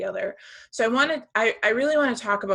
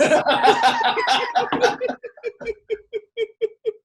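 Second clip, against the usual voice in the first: long, high, loud laughing fit; helpless laughter; 6 dB louder than usual. Real, not acted. Host cracking up at her own cannon joke, helpless laughter. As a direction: burst out laughing and let it run down.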